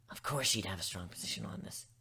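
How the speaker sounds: plain reading voice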